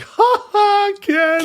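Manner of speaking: high mocking laugh; mocking listeners. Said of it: "are going like".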